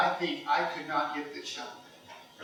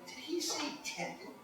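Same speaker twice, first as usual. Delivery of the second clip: soft, high-pitched disbelieving question; hushed aside; 10 semitones higher, 13 dB quieter than usual. Retold or imitated. Golfer to his caddy about the opponent, hushed aside. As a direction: drop to a hushed, puzzled aside.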